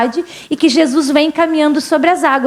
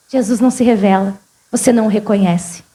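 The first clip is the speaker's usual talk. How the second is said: normal talking voice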